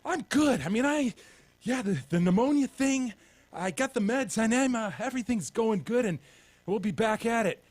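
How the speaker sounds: mimicking rambling sick friend. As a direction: ramble like a drugged-up buddy.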